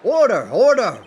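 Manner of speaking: loud, high repeated bellow of order; judge shouting order. Acted. Judge yelling at the rowdy court to settle, shouting.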